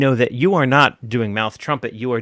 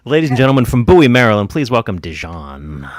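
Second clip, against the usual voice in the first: announcer intro; 5 dB louder than usual. Acted. Host introducing the next act.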